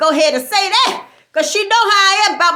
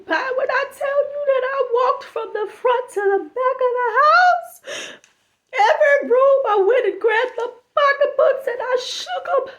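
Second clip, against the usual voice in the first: higher, slow, crying wail building to a shout; hammed-up blubbering lament; mock sobbing; 5 semitones higher than usual. Friend wailing that her thighs are too big, sobbing.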